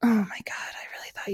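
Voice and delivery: whisper. Real, not acted. Disbelief that friend missed song.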